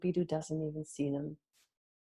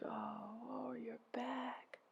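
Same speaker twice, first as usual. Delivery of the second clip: whispery, lifted groan of sympathy; soft echoed groan; 4 semitones higher, 16 dB quieter, much slower than usual. A narrator's reading. Helpers echo Ereshkigal's pain, hushed empathetic groan. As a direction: groan it back very softly. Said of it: "They groaned".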